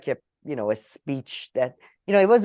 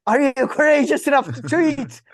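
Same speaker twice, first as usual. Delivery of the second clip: higher and louder, sneering mock question; mocking sarcasm; 9 semitones higher, 5 dB louder than usual. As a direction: ridicule the idea like a scornful heckler. Mocking imagined modern courage.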